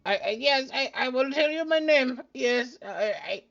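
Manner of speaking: high, flustered stuttering gnome; stammering gnome. Acted. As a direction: trip over the words nervously.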